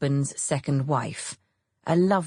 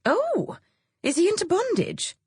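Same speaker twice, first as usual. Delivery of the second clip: much higher, delighted mock-shocked question; gleeful surprise. A narrator's reading. Celia teasing her friend about Roger, much higher gleeful surprise. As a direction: pounce on the juicy detail.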